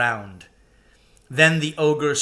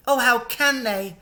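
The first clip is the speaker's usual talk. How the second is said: tearful; 11 semitones higher than usual. A narrator's reading. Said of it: "tears streaming down her cheeks".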